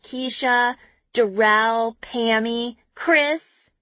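ordinary delivery

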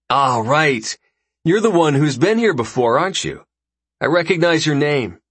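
lightly voiced breezy host; easygoing dinner host, a bit brighter; casual host voice. Raxus recognizing Val.

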